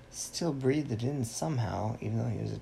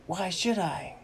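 put-on high-pitched girlish character retort; Little Bo Peep; 10 semitones higher than usual. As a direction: answer back in a high put-on voice. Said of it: "And she said".

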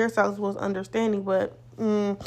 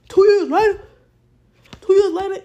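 high, loud exclamation of disbelief; worked-up exclamation; 9 semitones higher, 8 dB louder than usual. Acted. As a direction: cry it out to your bro, pitched way up. Exclaims to bro about delayed settlement.

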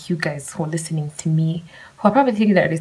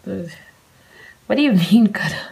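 usual speaking voice

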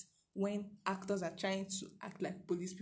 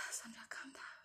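soft whispered self-calming; 4 semitones higher, 14 dB quieter than usual. Real, not acted. Speaker telling herself to calm down, whispered. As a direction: breathe and hush yourself.

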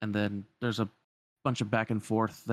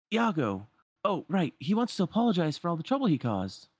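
put-on higher-pitched young hero voice; Aladdin, remembering; 8 semitones higher than usual. Aladdin explaining Iago's apology to Sora, casually acted. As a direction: explain it in a raised character voice.

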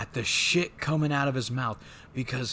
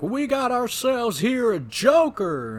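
much higher, louder, slow mock bellow; mock drill sergeant yell; 11 semitones higher, 7 dB louder than usual. Host imitating the drill sergeant naming Joker, mock yell. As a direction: yell it at the recruit. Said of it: "dialed to eleven in volume because he's just screaming".

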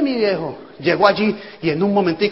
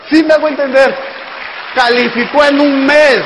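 higher and louder, proud emphasis; triumphant shout; 6 semitones higher, 7 dB louder than usual. Real, not acted. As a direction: sell the win to the crowd. Triumphant point to audience.

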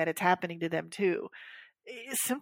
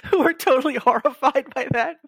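high, loud, laughing speech; real amusement spilling into the words; laughing through it; 8 semitones higher, 8 dB louder than usual. Real, not acted. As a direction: talk through your own laughter. Still laughing about the taxes.

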